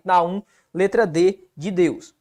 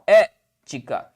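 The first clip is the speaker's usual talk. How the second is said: "ética" slow, É held apart from ti-ca; syllable separation; 7 semitones lower, much slower than usual. Shown as a demonstration. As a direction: split "ética" after a stressed É. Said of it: "qual a separação de ética?". ("ética" devagar, com pausa depois do É)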